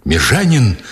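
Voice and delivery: mildly desperate startled call; desperate cry. A narrator's reading. Nikitin finds the sergeant in the room, a restrained desperate cry. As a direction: call out in contained despair. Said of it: "отчаянно крикнул Никитин".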